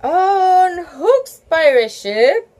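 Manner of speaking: usual speaking voice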